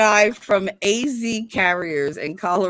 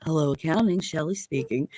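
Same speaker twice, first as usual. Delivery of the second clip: much lower and softer, seductive greeting; sultry phone voice; 8 semitones lower, 8 dB quieter than usual. Retold or imitated. Answering the office phone to a caller, sultry. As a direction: drop into a low, velvety phone purr. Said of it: "Oh my God, my dick is hard".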